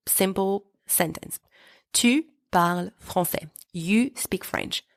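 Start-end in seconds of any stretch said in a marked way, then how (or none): 1.9-3.5 "Tu parles français" as a level, finished statement; calm declarative "Tu parles français", voice settling at the end; statement intonation. Shown as a demonstration. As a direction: say "Tu parles français" evenly, no rise on "français". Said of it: "That is a simple declarative sentence".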